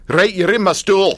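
high, loud mock local claiming his stool; gruff pub local. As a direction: bark it like the regular whose seat you took. Pub local confronts him, retold.

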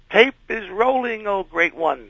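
high, slow, needling announcement; sarcastic high-pitched taunt; mock-grand taunt. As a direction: tease the great star with fake reverence. Needling the star on set.

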